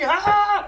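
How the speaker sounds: playful horror scream; mock scream. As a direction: shriek in hammed-up terror. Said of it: "Sorry, I had to say that".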